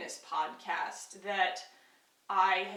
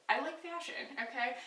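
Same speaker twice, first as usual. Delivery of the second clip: normal talking voice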